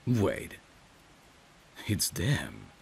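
ordinary delivery, nothing marked

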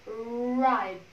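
"bribe" drawn out, b straight into r; blended cluster. Shown as a demonstration. Teacher blending the sounds for students, slow "bribe". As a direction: stretch "bribe" with no vowel after the first b. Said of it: "chúng ta mới bọc ra là bribe".

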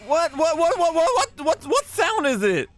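high, rapid-fire repeated WHAT; alarmed. Real, not acted. Gamer startled by an unknown sound, high alarm. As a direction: panic at an unknown noise.

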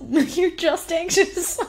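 high, slow, laughing mom voice; mocking mom calmly diagnosing her crying daughter; mom, amused. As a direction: tease it gently through a laugh, high and slow. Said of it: "my mom was like".

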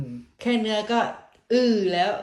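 talking through a bite of beef; words round unchewed food; mouth full. Real, not acted. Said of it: "เพิ่งจะใส่ปากยังไม่ทันได้เคี้ยว".

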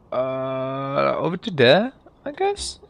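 usual speaking voice

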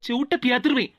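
normal talking voice

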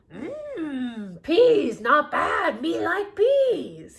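loud, slow, munching monster voice; loud slow Cookie Monster growl. Acted. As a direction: savor the peas like a greedy monster. Cookie Monster tasting peas.

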